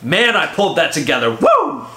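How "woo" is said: much higher whoop of triumph; woo cheer. Real, not acted. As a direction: celebrate yourself. Self-congratulation cheer.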